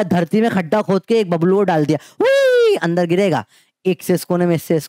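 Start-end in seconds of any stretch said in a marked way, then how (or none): 2.2-2.8 high squeaky falling whoosh; playful mock whoosh; imitated whoosh. Shown as a demonstration. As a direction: sound effect of something dropping in.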